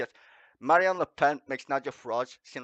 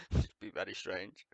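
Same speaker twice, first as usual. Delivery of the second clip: breathy laugh; 10 semitones lower, 10 dB quieter than usual. Real, not acted. Laughing aside.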